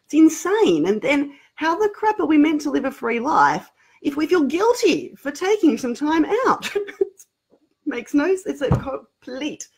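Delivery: rising, disbelieving protest; exasperated outburst. Real, not acted. Exclaiming indignation.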